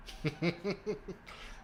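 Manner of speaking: soft, high giggle; amused snicker. Real, not acted. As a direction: laugh quietly to yourself. Laughing at being unsubscribed.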